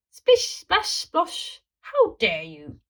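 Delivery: splash then be scandalised; offended. A narrator's reading. Plesiosaurus offended in the bath.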